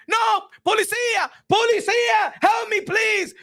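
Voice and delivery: high mock-panicked shouting; panicked emergency call. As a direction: shout for the police in hammed-up terror. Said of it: "I call nine one one".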